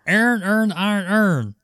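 imitating the Baltimore guys, all vowels merged; "Aaron earned iron urn" as one drawled sound; Baltimore accent. Retelling the video, in a mock Baltimore accent. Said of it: "the Baltimore guys are like".

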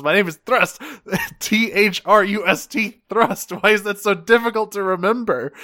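high-pitched mock exasperation; retold snapping. Host retelling Thrust correcting his name, high exasperated snap. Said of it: "he fucking snaps and says".

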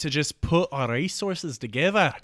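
put-on Scottish sailor voice; hammed-up Scottish accent. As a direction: reason with them in a mock Scottish accent. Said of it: "he's gonna be Scottish".

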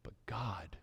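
low, very soft whisper. Shown as a demonstration. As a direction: hush right down, almost voiceless.

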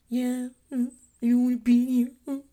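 put-on child voice. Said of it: "voicing the child's reply".

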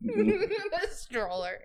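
slow, high and laughing. Real, not acted. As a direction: laugh the words out. Laughing at the stroller joke.

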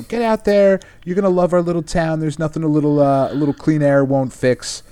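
a hint of an overly welcoming innkeeper; sweet landlady. Landlady welcoming the stranger, lightly voiced and sweet.

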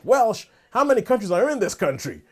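hammed-up astonished question; baffled Ted Lasso. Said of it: "He's like".

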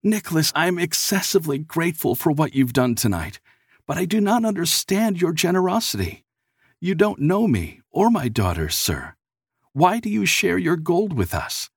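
high-pitched, earnest bewilderment; perplexed. A narrator's reading. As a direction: question the gift in grateful confusion. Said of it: "The man was sincerely perplexed".

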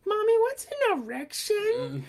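put-on high, slow little kid voice; squeaky child asking innocently. Kid with ice cream quoted.

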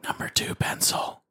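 breathy unvoiced whisper. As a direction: whisper it into the ear. Whisper round of the game.

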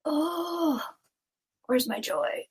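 high wailed oh, dropping back down; lightly acted moan of loss; mock lament. Speaker realizes her joy has gone, mock lament.

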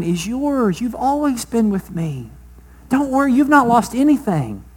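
affectionate father. Acted. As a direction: reassure him like a loving dad. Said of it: "affectionate, loving word".

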